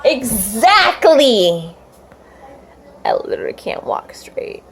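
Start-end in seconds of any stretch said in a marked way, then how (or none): 3.1-4.6 whispered, slurred confession. Real, not acted. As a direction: breathe it out, barely voiced. Drunk girl murmuring confession.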